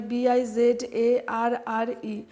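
slow letter-by-letter spelling; teacher dictating a spelling. Shown as a demonstration. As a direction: spell each letter slowly and clearly. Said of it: "বাইজার বলতে পারি".

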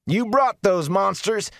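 high, loud, slow roar; furious roar at the Aes Sedai. A narrator's reading. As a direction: bellow it at her in accusation. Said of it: "Darl roared".

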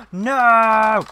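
real shock bursting out at the screen; sudden alarmed yelp. Real, not acted. Gasps as the dragon gets stuck.

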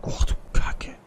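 hushed whisper under the breath; whispered cursing. Real, not acted. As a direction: whisper the curse tensely. Tense moment, cursing under breath.

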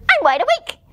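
very high, loud little bird voice; baby bird. Acted. Baby bird protesting to the giraffe, high and piping.

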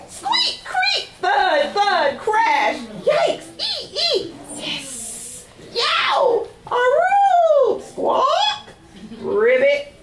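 very high squeals and yelps; squawks, howls and yikes; chaotic animal cries. Acted. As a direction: cry out as the bed crashes. Bed breaks, animals cry out.

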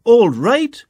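high, loud yelled demand; angry yell. A narrator's reading. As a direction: yell it at him. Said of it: "she yelled".

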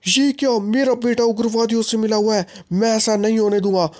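high-pitched outburst of disbelief; shocked father. Acted. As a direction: react in shock, voice jumping up. Father overhears son joined militants.

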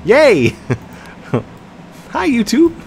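high, loud gleeful cheer; hammed-up squeal of delight to viewers; mock excited greeting. Acts out boss greeting the viewers.

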